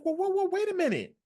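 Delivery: very high-pitched, incredulous halt; mock disbelief. Host reacting on reading the statement, mock disbelief. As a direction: put the brakes on in a high squeal.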